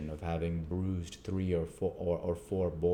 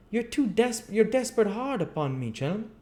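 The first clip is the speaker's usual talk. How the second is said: much higher, pleading rough voice; wavering chimney sweep; 5 dB louder than usual. Acted. Gamfield wavers before the board, high and pleading. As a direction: give in with a complaint. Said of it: "said Gamfield, wavering".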